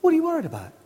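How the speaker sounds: high, breezy dismissal; acted high-pitched reassurance; tempting devil. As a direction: brush the worry off in a high lilt. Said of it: "The devil says".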